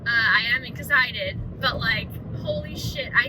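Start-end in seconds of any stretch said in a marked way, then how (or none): 2.4-3.1 shocked. Real, not acted. Shocked at having a boy.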